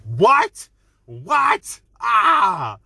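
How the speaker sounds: high, loud shocked cries; reliving the jaw-drop moment out loud; shocked shout. Retold or imitated. Reviewer reenacting shock at the twist, high shouted cries. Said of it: "my jaw was like".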